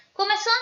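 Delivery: "Comezón" with most intensity on the final "zón"; stress on last syllable. Shown as a demonstration. Teacher showing where intensity falls in the word, final-syllable stress. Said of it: "cae con mayor intensidad en son".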